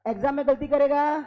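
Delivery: ordinary delivery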